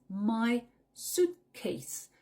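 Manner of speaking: "suitcase" slow, stress on "suit"; stress first syllable. Shown as a demonstration. Teacher slows down to show the stress. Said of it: "Tu accentues la première syllabe suitcase".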